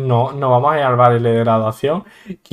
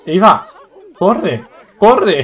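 raised, higher urging shout; urgent call; 5 semitones higher, 7 dB louder, much slower than usual. Pretend. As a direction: egg the character on, loud and high. Urging game character to run. (gritos apremiantes para que corra)

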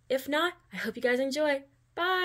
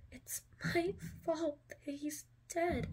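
slow, soft, tearful and higher; shaky crying; 6 semitones higher, 6 dB quieter, slower than usual. A narrator's reading. Girl blaming herself for her father's death, softly tearful. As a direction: choke it out through tears, slowly. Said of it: "you cried in a shaky voice".

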